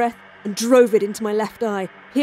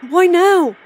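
panicked cry; 8 semitones higher, 7 dB louder than usual. Acted.

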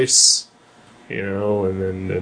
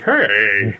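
Wolverine voice; 7 dB louder than usual. Retold or imitated. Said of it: "That's my Wolverine impression".

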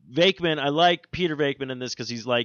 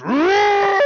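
high, loud, slow mock roar; ghost roar; 10 dB louder than usual.

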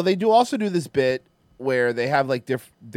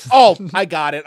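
loud, high burst of getting it; sudden realization; 4 semitones higher, 11 dB louder than usual. Retold or imitated. Comic realizing the vampire's joke, loud and high. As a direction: shout it out as the penny drops.

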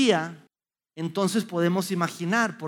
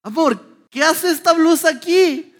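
higher, louder baffled question; retold surprise; 8 semitones higher, 7 dB louder, slower than usual. Father asking his wife about the dog shirt, baffled. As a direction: ask your wife in disbelief. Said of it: "Y le digo".

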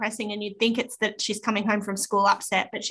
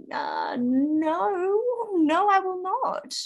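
mock refusal; 9 semitones higher, much slower than usual. Mother quoting sister's refusal.

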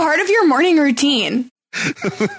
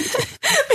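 high burst of laughter; 5 dB quieter than usual. Real, not acted. Laughing at spooky cat idea.

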